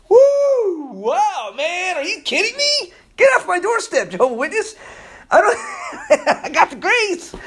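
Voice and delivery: high, whooping mock-dismissal; excited retelling. Preacher recalling first hearing the verse, giddy and high. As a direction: burst out giddy and laughing. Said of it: "I was like".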